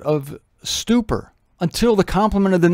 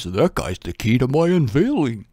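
low, soft dog's inner voice; voicing the dog; 7 semitones lower, 4 dB quieter than usual. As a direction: speak as a hopeful dog, lower and hushed. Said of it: "The dog says".

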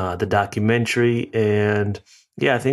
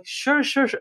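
eager waiter. Retold or imitated. Waiter happily agrees to discount.

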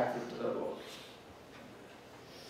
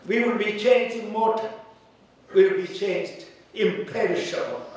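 higher, louder preaching with amens; 8 semitones higher, 4 dB louder than usual. Acted. Pastor preaching resurrection to the congregation, fervent. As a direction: drive it home fervently.

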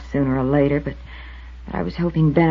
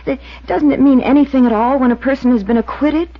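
higher and louder, rising in desperate disbelief; pleading outburst; 8 semitones higher, 7 dB louder than usual. Acted. Waitress pleading with the investigator, high, loud and rising. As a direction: she can't believe he doesn't know, pushing up and up.

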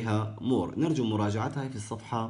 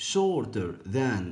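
slow drill; much slower than usual. Shown as a demonstration. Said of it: "teacher drilling comparative form".

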